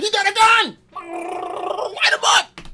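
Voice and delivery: high loud frantic cop shout, slow on the last words; panicked cop yell. Retold or imitated. Cop spotting a remote as a gun, frantic mock yell. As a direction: yell it like a trigger-happy cop. Imitating police shouting at him.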